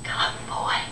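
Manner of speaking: soft, breath-only whisper. Acted. The tree can hardly speak for happiness, whispering. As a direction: whisper it, too happy to speak up. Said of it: "she whispered".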